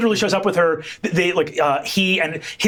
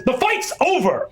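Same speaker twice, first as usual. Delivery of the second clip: announcing; 8 semitones higher than usual. Acted. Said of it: "and says".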